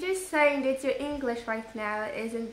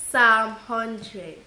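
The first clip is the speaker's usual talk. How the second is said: Bible recitation; 5 dB louder than usual. Pretend. Child reciting a psalm for the family, declaimed recitation. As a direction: recite scripture on one steady note, projecting.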